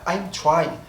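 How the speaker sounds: usual speaking voice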